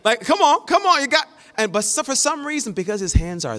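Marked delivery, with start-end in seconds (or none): 0.2-1.3 high, loud come on; dad spotting his son, much higher and louder; cheering on. Retold or imitated. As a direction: cheer him through the rep.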